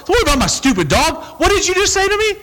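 high, indignant hammed-up outburst; mock angry retort. Person with the kicked dog snapping at a friend, mock angry and high. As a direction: snap back, voice climbing high.

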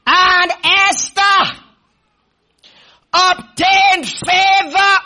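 high, loud, drawn-out scripture declamation; full-force preaching. Real, not acted. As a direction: proclaim it slowly at full voice.

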